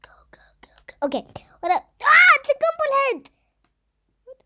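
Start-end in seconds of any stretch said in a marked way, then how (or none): 0.0-0.9 hushed rapid go go; urgent whisper. Real, not acted. Sneaking past the enemy. 2.0-4.4 startled shriek. Real, not acted. Startled by the monster.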